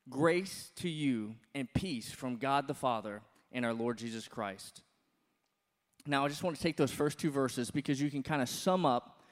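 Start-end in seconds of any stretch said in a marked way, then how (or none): 0.1-4.8 slow, soft scripture reading. Acted. Pastor reads the verse to the congregation, slow and reverent. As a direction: read the verse slowly and reverently.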